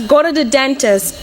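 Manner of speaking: normal talking voice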